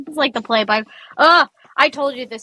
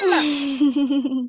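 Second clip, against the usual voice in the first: drawn-out call for a parent; tattling kid; 3 semitones higher than usual. Retold or imitated. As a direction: mimic a kid yelling for backup. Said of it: "you just hear your opponent go".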